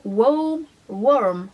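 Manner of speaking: "Warm" said the American way, as one rolled syllable with a W; American accent. Real, not acted. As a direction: say "Warm" like a Californian. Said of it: "mon accent". ("Warm" prononcé avec un accent américain)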